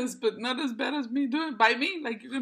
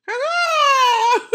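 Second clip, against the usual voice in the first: high squeaky greeting; 11 semitones higher, 9 dB louder than usual. Pretend. Greeting her daughter in a squeaky filter voice. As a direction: shriek hello way up high and loud, then laugh. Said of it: "what if I do Kiko like this".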